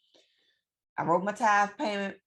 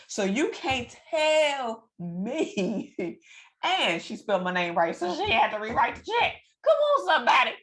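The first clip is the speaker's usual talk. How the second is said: higher, louder, rising fervor; preachy excitement; 6 semitones higher, 3 dB louder than usual. Real, not acted. To her viewers, rising excited church fervor. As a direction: build it up like a preacher.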